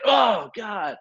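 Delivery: high-pitched yell of shock; pained yell. Retold or imitated. Rescue swimmer reliving an electric shock from the helicopter, pained yell. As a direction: cry out as if zapped. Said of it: "like, yelled".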